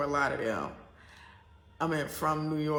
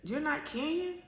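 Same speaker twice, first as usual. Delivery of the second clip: much higher, rising surprised question; airport lady's surprised question; 12 semitones higher than usual. Retold or imitated. Airport worker asking the traveler, higher surprised question. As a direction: sound amazed that she isn't local. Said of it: "she looked at me and she said".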